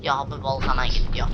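ordinary talk, nothing marked

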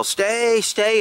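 a firm, mild mock yell to a distant dog; retold yell. Retelling commanding his dog from the car, mild mock yell. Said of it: "I'd yell out the window".

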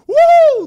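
high, loud victory whoop; joyful whoop. Real, not acted. Gamer whooping after a girl says yes. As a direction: cheer out loud like you just won.

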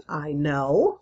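usual speaking voice

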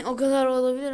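loud, fast, laughing; loud laughing burst. Real, not acted. Gamer laughing about the character's age, loud laughing burst. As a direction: blurt it out through a laugh.

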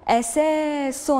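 very slow, stretched syllables; أساس read very slowly, each syllable stretched; drawn-out model word. Shown as a demonstration. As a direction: hold each sound of أساس. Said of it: "انتقلوا الكلمة الموالية".

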